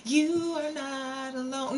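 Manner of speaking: high, slow, eerie doll voice; a drawn-out ghostly warning; creepy doll voice. Acted. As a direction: speak like a haunted doll closing in. Haunted doll speaks to Frank.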